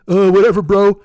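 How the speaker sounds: loud, dopey mock brush-off; dismissive troll. Online troll shrugging off the retort, loud and dismissive. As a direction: wave it off loudly as a troll bro.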